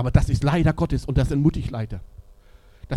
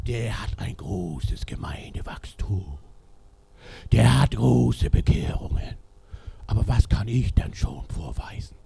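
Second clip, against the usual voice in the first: low, slow, hushed envy; envious whisper; 4 semitones lower, slower than usual. Retold or imitated. Envious minister muttering about a rival's church, slow whisper. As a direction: whisper it like a jealous minister comparing himself.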